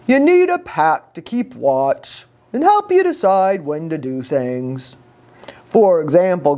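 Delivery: lightly voiced lazy dog; sleepy dog voice. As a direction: speak like a dozy old dog. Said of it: "Pushkin, the dog ... rolled over and said".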